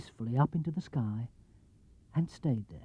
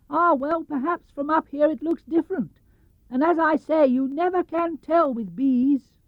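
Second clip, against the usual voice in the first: Pooh bear; 11 semitones higher than usual. Acted. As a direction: speak as the little bear. Pooh voice held.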